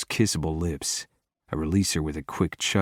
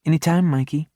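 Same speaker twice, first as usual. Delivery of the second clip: sweet, higher cooing voice; 9 semitones higher, 5 dB louder, faster than usual. A narrator's reading. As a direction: coo it sweetly. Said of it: "Jesse coos back".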